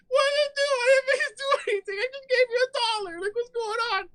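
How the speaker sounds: very high, squealing self-defense; hammed-up frightened falsetto; mock panic. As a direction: plead innocence in a panicked squeak. Acts out his panicked reply to her.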